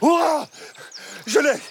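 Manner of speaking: excited cry. Acted. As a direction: yelp as he grabs it. Captain catches lizard on back.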